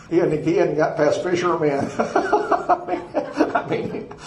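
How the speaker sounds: real laughter spilling into the line; amused laughing. Real, not acted. Laughs while retelling his confrontation.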